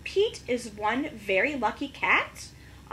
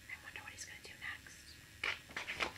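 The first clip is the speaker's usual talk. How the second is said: whispered, much lower and softer aside; suspense whisper; 12 semitones lower, 18 dB quieter than usual. Real, not acted. Teacher asking the class what happens next, whispered.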